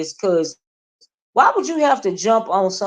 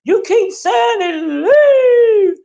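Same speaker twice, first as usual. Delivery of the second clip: high, loud mock yell of leave; shrieking imitation, much higher and louder; hollering woman impression; 13 semitones higher, 5 dB louder, much slower than usual. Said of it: "she was over there hollering".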